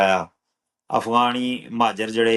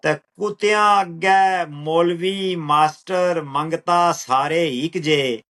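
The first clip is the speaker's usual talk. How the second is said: slow, measured verse with drawn-out words; poetry recital; 6 semitones higher, much slower than usual. Acted. Poet reciting own lines to an appreciative listener, measured recital. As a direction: recite it higher, easing down at the close.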